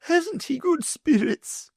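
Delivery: high, sugary lady's voice; greedy wife in a high fluting voice; the Wardeness. A narrator's reading.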